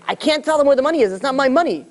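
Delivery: high-pitched, insistent acted refusal; retold protest. Rabbi refusing to give up charity money, mock-desperate protest.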